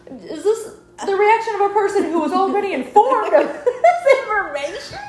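high, laughing and incredulous; mocking the aunt's overreaction, laughing high; laughing disbelief. Real, not acted. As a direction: laugh through the question in disbelief.

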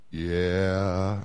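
low, drawn-out sheepish "Yeah". Acted. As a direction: answer like you've been caught. Mike answers God sheepishly.

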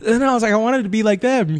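usual speaking voice

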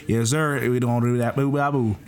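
mock Texas accent dissolving into gibberish. Shown as a demonstration. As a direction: put on a playful Texas twang. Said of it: "like, a Texas accent".

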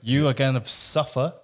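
loud indignant scolding; body scolding. Retold or imitated. Body berating its owner, loud angry scolding. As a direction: berate yourself as your own neglected body. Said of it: "My body was swearing at me".

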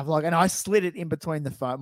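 ordinary delivery

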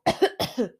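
dry cough mid-sentence; coughing fit. Real, not acted. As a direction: clear the throat with a cough. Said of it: "I've been coughing this whole time".

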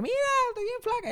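a high, fast, prissy show-off voice; mocking bragging voice. Podcaster mocking how [name] didn't brag, mock boast. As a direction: chirp it like a vain celebrity.

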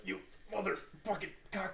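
high, strained curse while wrenching. Retold or imitated. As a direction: curse it through the strain of a stuck bolt. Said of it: "and you're like".

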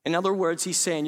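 normal talking voice

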